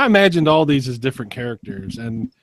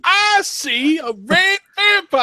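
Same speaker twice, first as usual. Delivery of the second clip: high, slow squeaky cat; slower than usual. Pretend. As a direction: pipe it up like a tiny cat.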